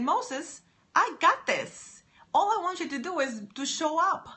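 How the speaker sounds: high, loud, booming voice of God; God speaking. Retold or imitated. God answering scared Moses, a loud booming voice. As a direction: call it out like God reassuring Moses. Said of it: "But God said".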